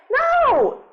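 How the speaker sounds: high, loud, drawn-out no; emphatic shout. Acted. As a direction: cut the complaint off with a big high shout. Emphatic rebuttal to that complaint.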